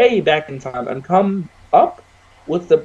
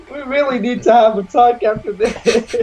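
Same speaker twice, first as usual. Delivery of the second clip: laughing; 5 semitones higher than usual. Real, not acted. Laughing at friend's odd answer.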